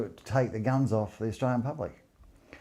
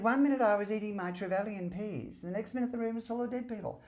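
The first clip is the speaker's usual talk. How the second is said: much higher childlike mimicry; imitating Martin Bryant; 11 semitones higher than usual. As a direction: play him as a meek little boy. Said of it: "He spoke like a little baby poofter".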